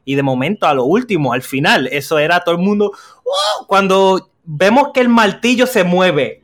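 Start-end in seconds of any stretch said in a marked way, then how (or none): 3.2-3.7 high cheer. Retold or imitated. Whole cinema cheering, retold.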